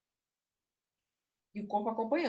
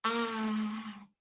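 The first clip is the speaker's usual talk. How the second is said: dreamy adoring; much slower than usual. Shown as a demonstration. Teacher voicing the puppet's dreamy adoring line, slow. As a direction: sigh it out lovingly, very slowly. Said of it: "ela vai dançar o jeito que você tá falando".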